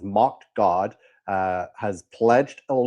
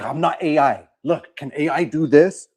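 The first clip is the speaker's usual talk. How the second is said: higher, louder imitation of someone protesting; mock insistent; 5 semitones higher, 4 dB louder than usual. Host mimicking the actor proving he's human, mock insistent. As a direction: plead your case with hammed-up insistence.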